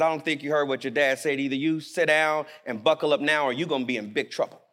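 low, rapid-fire scolding mom; stern mother. Acted. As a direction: scold in a low, quick, controlled voice. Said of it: "Mom turns around, grabs the son by the ear".

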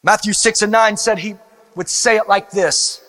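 reverent. Acted. Quiet reverent prayer section.

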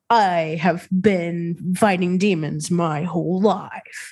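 slow, drawn-out TV ghost-hunter voice; Zach Bagans. Acted. Host imitating the narrator voice they wish they had, slow and self-serious. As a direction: intone it slowly, with heavy mock gravity. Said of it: "like, Zach Bagans, where he's like".

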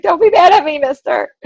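mock scared pleading. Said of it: "I was so scared".

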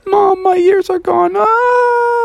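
very high, squealing panicky frog; panicked wail. Acted. Froggy tells his mom his ears are gone, panicked wail. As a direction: wail into a cartoon scream.

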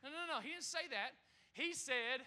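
usual speaking voice

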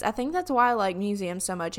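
normal talking voice

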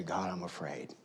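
soft, low confession to God; quiet fearful prayer; hushed prayer. Pretend. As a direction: breathe it out like a private prayer. Prayer voiced softly in sermon.

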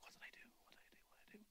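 put-on confused young man whispering to himself after apologizing; whispered inner thought. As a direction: whisper it to yourself, bewildered.

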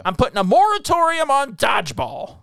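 high-pitched, emphatic decree; mock exasperation. Podcaster banning dodgeball talk, exasperated. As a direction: lay down the law in mock outrage. Comic ban on the topic.

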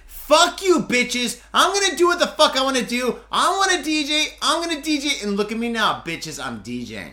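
loud, high, cocky shouting; defiant yell. Retold or imitated. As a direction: shout it at the doubters. Acting out defiant rant.

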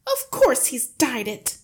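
high, loud wife; exasperated cry. A narrator's reading. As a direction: cry out as if it's obvious. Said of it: "the mother cried".

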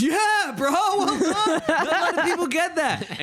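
high, laughing bro door; impressed door face. Acted. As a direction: congratulate like a chuffed bro. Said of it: "The door face kinda goes".

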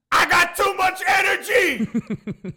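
very high, slow shrieking yell; manic yell. Retold or imitated. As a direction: scream it, wired and frantic. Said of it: "he yells".

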